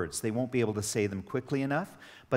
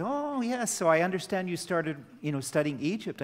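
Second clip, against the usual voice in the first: much higher, sugary-polite tone; overly nice; 6 semitones higher than usual. Retold or imitated. A parent being very polite to his son's teacher, in a high, sugary tone. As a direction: sweeten it, playing nice. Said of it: "I tried to be very nice".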